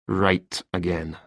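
slow, soft, faintly bitter admission; subdued, slightly sour reply; hint of bitterness. A narrator's reading. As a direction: concede it quietly with a touch of resentment. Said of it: "A hint of bitterness had crept into Thompson's voice".

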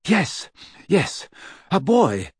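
dazed happiness. A narrator's reading. Lupin confirming it's a boy, dazed and happy. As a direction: repeat it, overwhelmed with joy. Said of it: "seemed dazed by his own happiness".

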